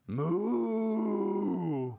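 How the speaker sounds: long, sustained moo; cow moo. Acted. Puppy George answering the vet, long cow moo. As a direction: bellow out a drawn cow sound. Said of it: "And George went".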